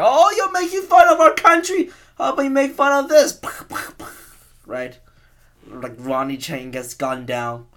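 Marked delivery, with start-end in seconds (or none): 0.0-3.4 much higher, put-on voice, a sneering patriot taunting the comedian; mock patriot voice. Gunman taunting the Daily Show guy, high cartoonish sneer. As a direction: squeak it out as a cartoonish vigilante. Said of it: "He's just like".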